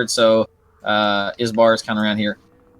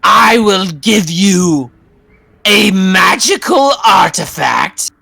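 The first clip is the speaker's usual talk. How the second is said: slow, high, loud witch offer; bargaining witch; 10 semitones higher, 7 dB louder, much slower than usual. Acted. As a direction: tempt them with choices. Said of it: "she says".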